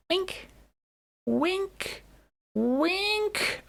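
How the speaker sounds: high, thin little squeak on each wink; tiny high-pitched cartoon wink; squeaky wink voice. Playful mock winking.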